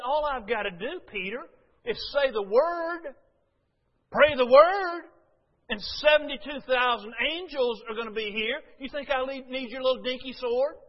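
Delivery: voicing Jesus scolding Peter, rising hard; forceful rebuke.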